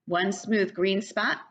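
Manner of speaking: usual speaking voice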